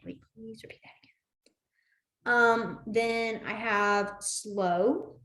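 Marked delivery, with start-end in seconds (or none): 0.1-1.1 fast, breathless whisper of please repeat; hurried whisper. Pretend. Learner begging a fast signer to repeat, fast whisper. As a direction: whisper it quickly, overwhelmed. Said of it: "you're like".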